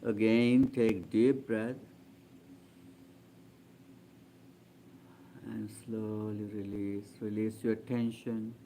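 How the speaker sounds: calm, spacious meditation-leader voice; guided meditation. Real, not acted. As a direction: gently guide them into stillness, voice settling down.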